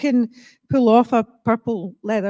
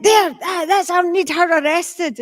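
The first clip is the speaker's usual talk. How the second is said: high, loud mock outrage; comically furious, stumbling and punched out; angry wee man; 9 semitones higher, 4 dB louder than usual. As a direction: imitate a spluttering little man demanding action. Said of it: "the angry wee man".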